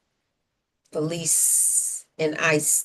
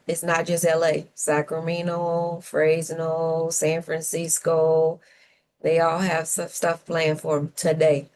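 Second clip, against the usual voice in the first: ordinary delivery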